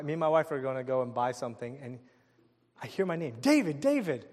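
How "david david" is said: raised, higher call to a friend. Retold or imitated. Former coworker hailing the speaker at Circuit City, calling out. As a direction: call the name twice as if from across a store.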